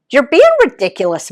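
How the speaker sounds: high, loud, fast exasperation; exasperated. Acted. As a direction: scold her impatiently. Exasperated daughter.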